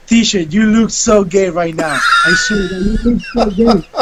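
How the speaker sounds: giggling, half-shouted private joke; laughing inner thought. Retold or imitated. Student thinking about teacher, laughing mock shout. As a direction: laugh through the thought you shouldn't say. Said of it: "That's what I said on my head".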